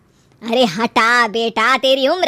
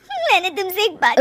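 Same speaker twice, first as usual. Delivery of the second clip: high tearful voice, close to sobbing; crying; 8 semitones higher, 3 dB quieter than usual. Acted. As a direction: choke the confession out through tears. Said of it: "वो रोने लगती है". (रोते हुए, भर्राई आवाज़ में)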